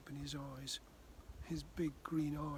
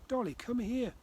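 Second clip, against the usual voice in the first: higher, louder call to the dog; shouting; 8 semitones higher, 7 dB louder than usual. Acted. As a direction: call the dog back sharply. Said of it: "shouted Abby".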